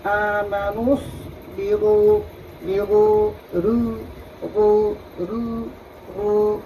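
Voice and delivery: slow, drawn-out recitation on a level tone; Quran recitation drill. Shown as a demonstration. Teacher demonstrates thin and heavy letters, measured recitation. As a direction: recite syllable by syllable, holding each long vowel. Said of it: "চিকন মোটা বোঝা যাচ্ছে না?".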